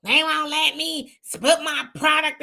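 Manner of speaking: mocking whine of the complainers. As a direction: drag it out like a sulking complainer.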